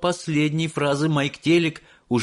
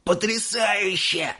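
put-on high, slow amazed old man; amazed exclaim; 9 semitones higher, slower than usual. A narrator's reading. Grandpa exclaiming at Wonka's invention. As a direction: savour the wonder. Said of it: "воскликнул дедушка Джо".

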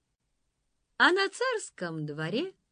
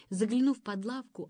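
usual speaking voice

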